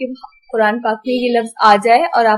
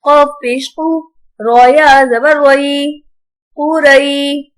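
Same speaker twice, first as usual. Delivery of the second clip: slow, measured recitation with long-held vowels; Quran spelling drill; 2 semitones higher, 3 dB louder, much slower than usual. Shown as a demonstration. Teacher spells out Arabic words for pupils, drawn-out recitation. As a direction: chant each syllable out for pupils.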